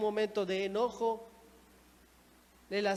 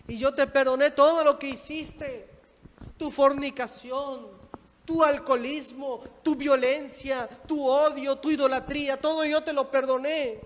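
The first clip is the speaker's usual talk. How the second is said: loud, raised preaching voice, hammering each item; impassioned rebuke; 3 semitones higher, 4 dB louder than usual. Acted. God reproaching the forgiven sinner who will not forgive, impassioned. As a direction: reproach with full pulpit force.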